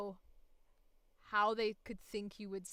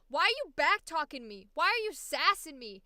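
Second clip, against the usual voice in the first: mock scolding mom; 5 semitones higher, 7 dB louder than usual. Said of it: "their mom or someone would get mad at them".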